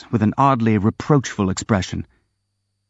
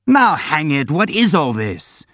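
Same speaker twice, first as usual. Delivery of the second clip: angry shout; 5 semitones higher than usual. A narrator's reading. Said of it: "shouted an angry Ardalion".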